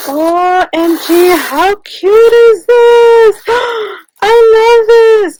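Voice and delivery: high, loud, slow gushing squeal; overwhelmed with delight at a gift. Real, not acted. Crafter opening a gift box, squealing with delight. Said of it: "I can't even talk".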